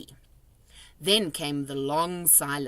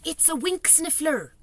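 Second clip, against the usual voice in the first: thrilled whisper; 10 semitones higher than usual. A narrator's reading. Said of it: "he whispered with a thrill".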